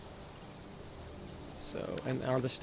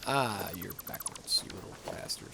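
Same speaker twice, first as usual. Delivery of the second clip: annoyed mutter; 6 semitones lower, 7 dB quieter than usual. Real, not acted. Building part placed backwards.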